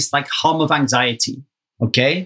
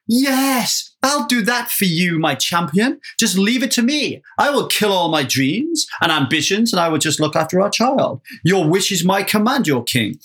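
mock devoted wife; 3 semitones higher than usual. Imagined wife surrendering everything for her husband, mock-adoring. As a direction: pretend to gush with total devotion to your champion. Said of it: "Liza just doesn't say".

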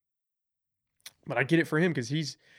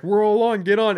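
calling out; 6 semitones higher, 7 dB louder than usual. Retold or imitated. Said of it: "And you'd be like".

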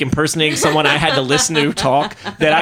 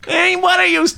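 high, slightly less over the top mock whine; Bill Burr voice again; 12 semitones higher than usual. Repeating the joke line, high mock Bill Burr whine. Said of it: "you've just committed to being Bill Burr".

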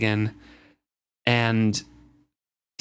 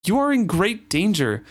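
severe; 9 semitones higher, 3 dB louder than usual. Acted. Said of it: "Kettle told him severely".

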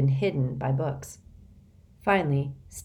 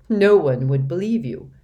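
firm emphasis; 9 dB louder than usual. Acted. Benedict presses his point.

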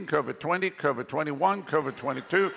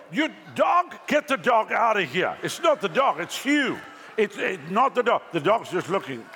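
mock angry blame; 3 semitones higher than usual. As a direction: yell at the dog, then at the person who blamed it. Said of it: "This terrible".

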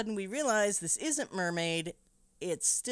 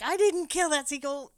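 louder, higher defensive denial; young Thomas denying the accusation, indignant; 9 semitones higher, 6 dB louder than usual. Retold or imitated. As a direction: deny it hotly. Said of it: "young Thomas is like".

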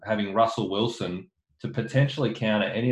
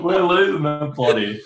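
laughing; 6 semitones higher, 7 dB louder than usual. Real, not acted. Laughing while hosting podcast.

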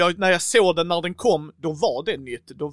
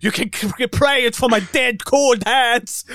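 put-on Scottish accent; 6 semitones higher, 6 dB louder than usual. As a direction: growl it like a stubborn Scots miser. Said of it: "med den skotska dialekten".

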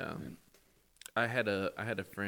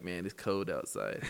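"cold" said as "coad", lower and relaxed; dropped L accent; 5 semitones lower than usual. Retold or imitated. A friend mimicking the speaker's dropped L, lower voice.

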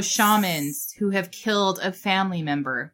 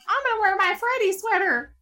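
mocking own voice; 13 semitones higher than usual. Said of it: "And then there was me".

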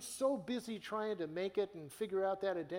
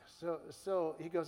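ordinary talk, nothing marked

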